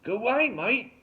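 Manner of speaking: loud, high, fake Aussie holler; bogus Australian accent. A narrator's reading. Derek sends intruders away, bogus Australian accent. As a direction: shoo them off in a hammed-up Australian accent. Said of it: "The bogus Steve Irwin accent was unmistakable".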